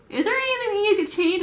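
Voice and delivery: high, loud acted question; mock questioning. Asking staff about the bags, high mock questioning. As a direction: imitate someone putting a question brightly.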